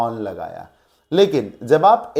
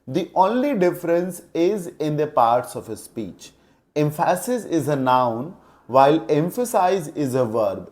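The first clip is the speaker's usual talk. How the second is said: slow dictation; much slower than usual. Shown as a demonstration. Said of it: "teacher dictating rule".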